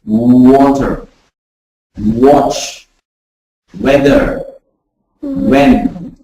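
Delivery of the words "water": "water" said again with a rounded w. Shown as a demonstration. Teacher repeating "water" for the class, rounded w. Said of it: "Like, for example".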